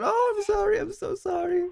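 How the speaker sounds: hammed-up mother begging sorry; mock apologizing mother. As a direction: overplay the frantic apology. Rosa voiced apologizing to Maria.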